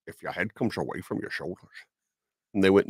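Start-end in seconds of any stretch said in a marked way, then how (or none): 0.1-1.8 lightly voiced, low-key Scottish film star; soft mock Spanish accent that stays Scottish; Sean Connery. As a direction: quietly impersonate the actor, ignoring the accent request. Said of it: "Rob does do a very good Connery".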